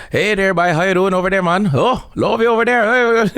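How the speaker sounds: high, loose comic Newfoundland accent. Shown as a demonstration. Comedian showing the host how Newfoundlanders talk, exaggerated Newfoundland accent. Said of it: "so their accent is very".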